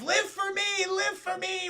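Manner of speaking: high hammed-up shout; dramatic cry. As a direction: cry it out like a hero. Sacrifice cry in retold scene.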